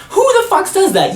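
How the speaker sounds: high, loud disbelief; appalled indignant outburst; outraged. Real, not acted. Outraged by roommate's question.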